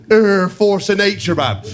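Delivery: mocking teammate drone. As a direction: stretch it out like a lazy taunt. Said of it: "repeats the taunt".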